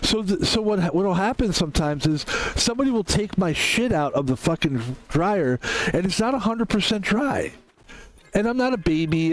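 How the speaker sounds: ordinary talk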